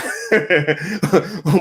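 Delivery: high-pitched chuckling laugh; laughing over a wild claim; amused laugh. Real, not acted.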